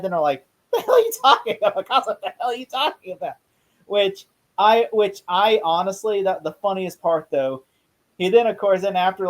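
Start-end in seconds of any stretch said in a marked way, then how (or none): 0.7-3.3 higher, fast incredulous cop; mock cop disbelief. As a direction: rattle it off like a baffled cop. Cops mocking Charlie's claim.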